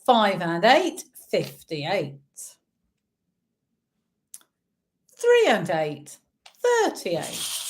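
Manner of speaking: sing-song bingo number calls; bingo caller. Acted. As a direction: call each number out like a bingo hall. Online bingo calling numbers.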